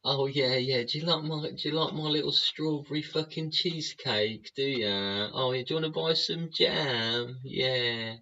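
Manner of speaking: high, sugary mock voice of a jam seller; sing-song high put-on voice, sending people up; mocking sweet seller. Ranter mocks pushy people in a high syrupy voice. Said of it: "people are just like".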